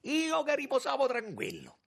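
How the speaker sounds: very high, loud, agitated outcry; frantic protest. A narrator's reading. Young man wringing his hands reproaches the minister, frantic. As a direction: cry out in desperate reproach. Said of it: "smaniando, torcendosi le mani".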